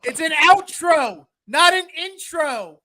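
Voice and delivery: high, slow, drawn-out call. Pretend. Host instructing his faraway co-host about the outro, slow holler. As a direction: spell it out for someone far away. Keeps calling out to faraway Josh.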